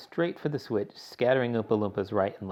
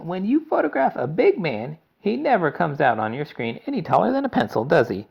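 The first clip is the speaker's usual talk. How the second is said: higher, louder eccentric showman explaining; Mister Wonka; 8 semitones higher, 8 dB louder than usual. A narrator's reading. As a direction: lecture brightly like a zany inventor. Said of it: "Mister Wonka explained".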